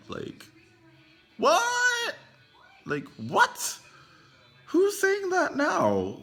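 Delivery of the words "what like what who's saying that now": high-pitched, disbelieving questions, drawn out; incredulous outburst. Real, not acted. Vlogger reacting to the Trump parallel, incredulous. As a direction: squeak it out in loud disbelief.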